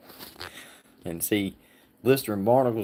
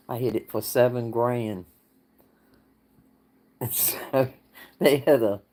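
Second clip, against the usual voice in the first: ordinary talk, nothing marked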